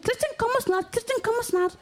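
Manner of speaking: high, chanting mock voice; high-pitched mimic of a man chanting; Iki's sing-song. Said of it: "og sagde sådan".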